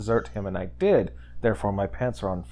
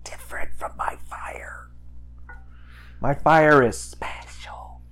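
breathy, confiding whisper; whispered, drawn out at the end; hushed whisper; 6 semitones higher, slower than usual. Acted. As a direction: whisper it like a proud secret. Confiding that his fire is special.

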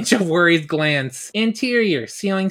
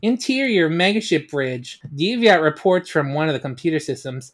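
plain reading voice

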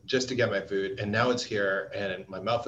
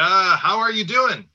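enthusiastic greeting; 8 semitones higher, 9 dB louder, slower than usual. Real, not acted.

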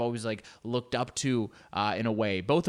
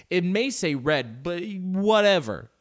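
slow, loud, much higher "whatever"; mock driver brushing off a red light; dismissive shrug; 7 semitones higher, 6 dB louder, slower than usual. Mocking Mexico City traffic rules.

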